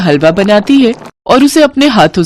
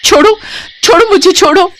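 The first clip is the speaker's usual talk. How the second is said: much higher, shrill panicked protest; frightened cry to let go; 13 semitones higher than usual. A narrator's reading. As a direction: yank free and cry out. Milkman grabs bride's hand. (घबराकर छुड़ाने की चीख़)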